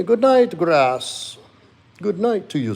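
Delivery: high, loud voiced dog saying good night; pet's voice. Streamer voices his dog saying good night to chat, high pet voice. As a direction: speak as the pet, squeaky and sweet. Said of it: "He says to tell you good night".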